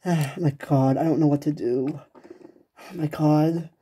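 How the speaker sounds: usual speaking voice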